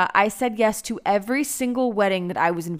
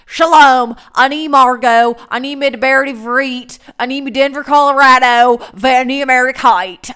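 put-on much louder, heavy American-accented Hebrew; mock-lunatic American accent in Hebrew, much louder; clumsy American Hebrew; 3 semitones higher, 11 dB louder than usual. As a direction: blurt out beginner Hebrew like a country bumpkin. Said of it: "there are days when I sound like a damn lunatic".

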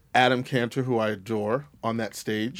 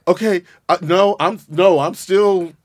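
louder, higher sassy cheering; sassy diva cheer; 5 semitones higher, 5 dB louder than usual. Retold or imitated. Host reliving watching My Fair Lady, cheering Haley on sassily. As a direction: cheer her on like a diva from the seats. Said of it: "and I was like".

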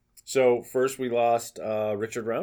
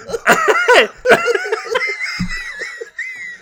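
long, high, loud laughing fit; 11 dB louder than usual. Real, not acted. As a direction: lose it laughing loudly.

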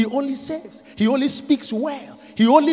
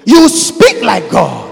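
louder, slow, emphatic; slow loud proclamation; loud declaration; 3 semitones lower, 13 dB louder, slower than usual. Acted. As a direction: proclaim it with full force. Refrain built up to loud.

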